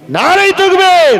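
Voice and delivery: high, loud rallying cry; stirring religious slogan shout. Real, not acted. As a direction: call out the takbir with full force. Leads the congregation's slogan.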